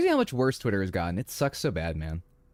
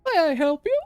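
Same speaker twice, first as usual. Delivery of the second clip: high-pitched, loud put-on shopkeeper voice; silly high tailor's greeting; tailor character voice; 11 semitones higher, 4 dB louder than usual. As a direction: play a hammed-up tailor, pitched way up. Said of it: "I don't know why I'm talking like that".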